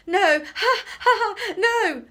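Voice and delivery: very high, loud shrieking no; desperate laughing scream; laughing cry. Acted. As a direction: protest through tickled laughter. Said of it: "cried Professor Drolling".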